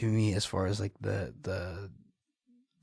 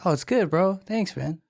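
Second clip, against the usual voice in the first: higher, louder casual brush-off; upbeat acted thanks; breezy reply; 8 semitones higher, 5 dB louder than usual. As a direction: play it off to a local, raised voice. Acts own breezy reply to locals.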